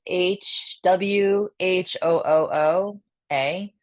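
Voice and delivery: slow breathy whooa exhale; breathy whoo spleen sound. Shown as a demonstration. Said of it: "Next is the sound of spleen".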